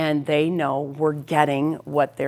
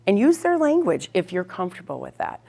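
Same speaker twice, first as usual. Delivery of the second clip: normal talking voice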